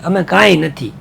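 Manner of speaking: usual speaking voice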